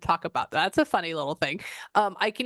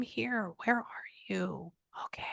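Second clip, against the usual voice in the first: searching whisper; 2 semitones higher, 8 dB quieter than usual. Real, not acted. Murmuring to unseen presence.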